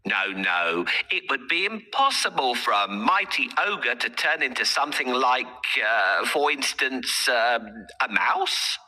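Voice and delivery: sly cat, coaxing the ogre. Acted. Puss tricks the ogre.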